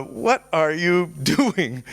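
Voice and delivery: slow, laughing mock question; drawn-out mocking laugh through the words; laughing taunt. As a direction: laugh at him mid-question. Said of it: "and he's laughing".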